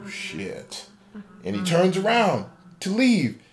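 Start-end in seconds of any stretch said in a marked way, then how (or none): 0.1-0.9 a breathy whispered shit; whispered curse. Acted. Guard spotting the marionette and Foxy curses in a whisper. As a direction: let it slip out as a whisper.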